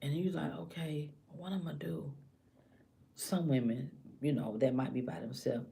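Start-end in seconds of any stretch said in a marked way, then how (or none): 0.5-2.1 very soft self-questioning; private deliberation. Acted. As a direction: ask yourself under your breath.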